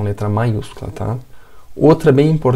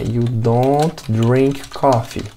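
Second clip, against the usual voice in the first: each English word slow and clear; teacher modelling English for students, slow; slow example sentence; much slower than usual. Shown as a demonstration. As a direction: model it slowly for learners. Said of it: "Ou eu vou dizer".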